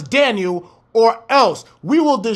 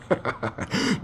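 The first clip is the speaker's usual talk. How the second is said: breathy laugh; 5 semitones lower, 11 dB quieter than usual. Real, not acted. Laughing at own point.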